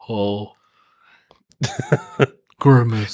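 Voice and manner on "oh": deep cartoon grunt. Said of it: "I was imagining the go".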